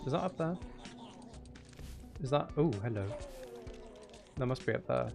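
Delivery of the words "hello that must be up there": usual speaking voice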